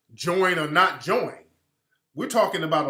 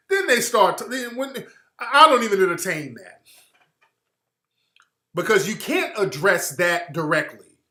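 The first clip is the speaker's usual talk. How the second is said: usual speaking voice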